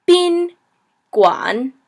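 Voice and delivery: teacher sounding out "bin", then "guan"; syllable-by-syllable pronunciation. Shown as a demonstration. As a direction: split "bin" and "guan" with a pause. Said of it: "so gu on".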